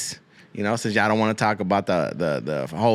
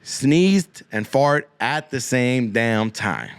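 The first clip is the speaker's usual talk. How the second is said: drawn-out, each word weighed; slow emphatic; much slower than usual. Real, not acted. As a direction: spell it out slowly. Slowed for emphasis.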